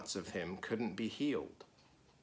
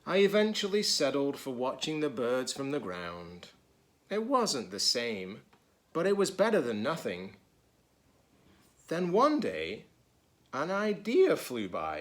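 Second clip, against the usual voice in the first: lively, higher storybook reading voice; reading to children; 4 semitones higher, 4 dB louder than usual. A narrator's reading. Teacher reading a picture book to children, lively. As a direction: read it brightly to the kids.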